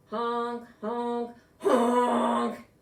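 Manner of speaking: loud honking goose, grumpy; grouchy goose honk. A narrator's reading. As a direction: honk like a cranky old goose, last one loudest. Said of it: "who sounded very grouchy".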